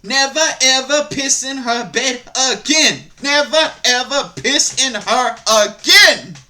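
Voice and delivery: loud, high, hammered-out warning; shouted mock threat, syllable by syllable; Major Payne. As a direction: punch every word like a movie tough guy scolding. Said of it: "That's what I wanna do".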